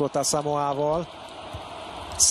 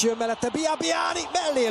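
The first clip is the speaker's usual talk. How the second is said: rising excited play-by-play; excited build-up call; 10 semitones higher than usual. Real, not acted. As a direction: lift as the attack builds. Attack builds in commentary.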